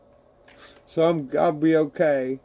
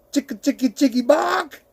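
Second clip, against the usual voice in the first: turkey cackle; 8 semitones higher than usual. Pretend. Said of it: "turkeys cackle like that".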